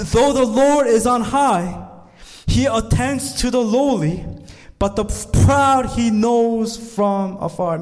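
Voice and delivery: high, loud, slow psalm proclamation; proclaiming scripture. Acted. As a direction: declare the verse to the whole room.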